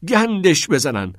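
slow, high, loud curse; frustrated yell. A narrator's reading. Todd alone on his bed in his room, yelling in frustration. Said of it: "فریاد زد".

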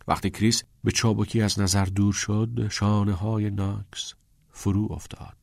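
plain reading voice

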